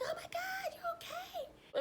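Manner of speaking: high-pitched mock panicked cry; retold alarm. Calling to a fallen friend on the stairs, high mock alarm. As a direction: squeal with alarm, drawing out the question. Reacting to friend falling downstairs.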